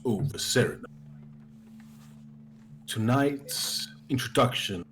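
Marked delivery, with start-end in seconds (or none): 2.9-4.9 low, measured storyteller intro; lower-pitched, deliberate fantasy recap; game master's scene setting. Acted. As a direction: set the scene gravely, in short phrases with long pauses.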